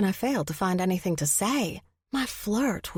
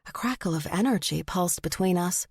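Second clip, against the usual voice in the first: plain reading voice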